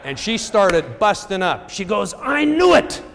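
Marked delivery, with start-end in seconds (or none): 2.1-3.0 loud, very high mock French cry; French accent. Seatmate busting up at his phone, loud high mock French accent. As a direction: crow it in a shrieky French imitation. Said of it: "in kind of this French accent".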